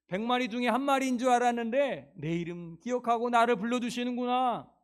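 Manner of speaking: loud, high joyful cry; overjoyed sheep. Acted. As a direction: burst out in grateful joy.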